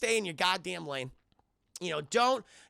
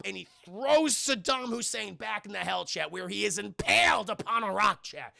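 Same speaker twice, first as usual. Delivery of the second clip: ordinary talk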